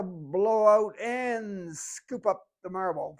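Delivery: slow words on a long out-breath; exhaling while speaking. Shown as a demonstration. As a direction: talk it out as you blow the air out slowly. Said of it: "And as I exhale".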